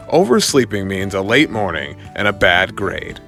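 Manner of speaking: ordinary delivery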